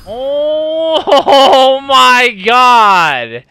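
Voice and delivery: loud, slow laughing cry of disbelief; real despairing laugh; exasperated laugh. Real, not acted. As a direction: laugh so you don't scream. Disbelieving laugh after dying.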